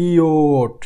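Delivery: ইওট said as ই, then ও, then a final ট; ইওট sounded out for learners; German J sound. Shown as a demonstration. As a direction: say ইওট slowly with each sound clear. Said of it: "মনে রাখতে হবে".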